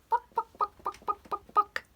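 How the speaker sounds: high clipped chicken clucks; hen clucking. Acted. Little Red Hen answering the teacher, high clucking.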